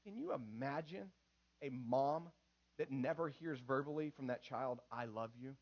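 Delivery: low, hushed, with long pauses; solemn appeal. Real, not acted. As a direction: let it sink in softly. Solemn deathbed appeal to congregation.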